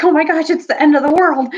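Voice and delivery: high, loud mock panic; panicked yell. Fleeing Telmarines crying out in mock panic, loud and high. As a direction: wail it like the sky is falling. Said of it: "they even yelled".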